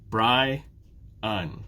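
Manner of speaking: "Bri-un" as two separate beats; syllable by syllable. Shown as a demonstration. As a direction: pause between the two halves of "Bri-un". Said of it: "Two syllables".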